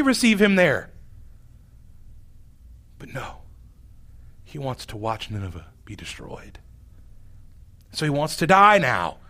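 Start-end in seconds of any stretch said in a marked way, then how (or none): 3.0-6.6 whisper. Acted. Secret wish said aside.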